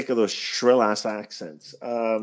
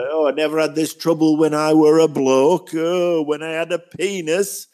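mock rant; 7 semitones higher than usual. Critic lampooning the episode's sexist dialogue, higher and hammed. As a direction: ham up a bitter bloke's grumble. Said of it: "it was just like".

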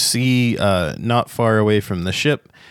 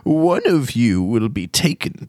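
put-on old gnome wizard; 7 semitones higher than usual. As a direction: warn of the curse like a creaky old sage.

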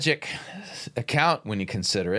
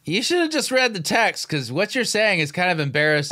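higher, louder fed-up reproach; exasperated scolding; 8 semitones higher, 4 dB louder than usual. Real, not acted. Commentator cutting into the sermon, exasperated and scolding. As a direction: scold him, embarrassed for him. Scolding preacher mid-clip.

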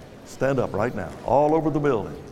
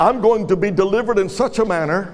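leading confession; 4 semitones higher than usual. Real, not acted. Pastor leads congregation's spoken confession.

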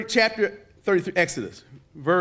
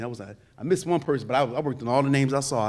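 normal talking voice

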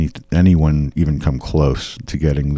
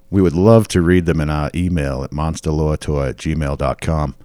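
usual speaking voice

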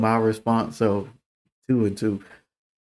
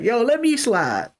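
1 high mock friend voice; 10 semitones higher than usual. Friend asks to come along.

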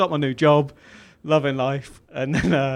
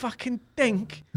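high, slow mock outrage; drawn-out, incredulous high-pitched imitation; going mad; 10 semitones higher, 4 dB quieter, slower than usual. Boss discovers the wrecked car, imitated as going mad. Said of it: "he was going mad".

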